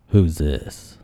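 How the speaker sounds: low, slow-to-warm dad on the phone; gruff dad. Retold or imitated. Dad answering a stranger's phone call, gruff. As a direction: drop low like a wary father. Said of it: "I'm gonna quote him".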